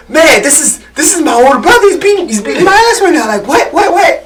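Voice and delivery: retold high-pitched disbelief; high panicked quote. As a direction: squeak it out in laughing panic. Retelling being beaten by brother.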